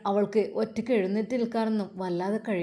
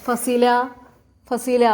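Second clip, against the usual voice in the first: calling out; 3 semitones higher, 6 dB louder, much slower than usual. Acted. Brother calls to his sick sister.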